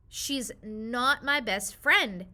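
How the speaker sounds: slow, loud, sulky denial; child protesting to mom; insistent. Acted. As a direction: drag it out in a loud pout.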